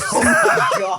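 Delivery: high, loud laughing cry; losing it with laughter; laughing hard. Real, not acted. Friend cracking up at the toddler story, loud laughing. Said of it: "Do you even know my love language?".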